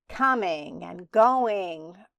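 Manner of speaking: ordinary delivery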